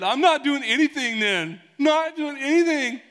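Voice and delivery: hammed-up child tantrum, very high and loud; defiant kid. Kid defying parents, high loud mock tantrum. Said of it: "have you ever had a kid".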